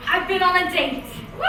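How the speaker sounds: ordinary delivery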